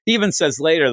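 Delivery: normal talking voice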